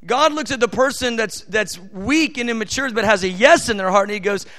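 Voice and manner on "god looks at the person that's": normal talking voice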